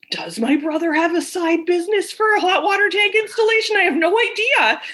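high, laughing inner thought; half laughing at the absurdity; a high giggly what-is-going-on; laughing disbelief. Retold or imitated.

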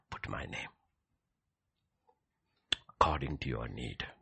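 usual speaking voice

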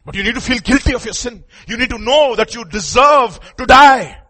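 high, loud pulpit warning; forceful preaching. Real, not acted. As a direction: drive the warning home to the congregation.

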